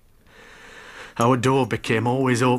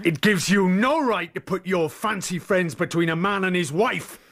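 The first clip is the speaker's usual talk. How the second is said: higher, heated scolding; angry rebuke; 8 semitones higher than usual. Acted. Husband scolding his wife's sister, heated. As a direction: tear into her for meddling.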